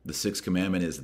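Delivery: normal talking voice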